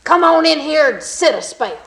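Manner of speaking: loud gruff holler; hollered invite. Acted. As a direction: call him in across the yard. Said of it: "he hollered at that stranger".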